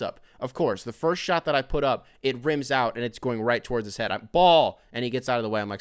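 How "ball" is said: loud warning shout; sharp warning yell across the court. Retold or imitated. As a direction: yell it to warn him off.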